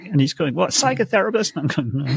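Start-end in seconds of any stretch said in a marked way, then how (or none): 0.5-1.6 high-pitched, puzzled mishearing; deaf old father. Retold or imitated. Deaf host's father asking what I do, puzzled and high-pitched. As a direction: imitate a hard-of-hearing man checking what he heard. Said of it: "he was deaf".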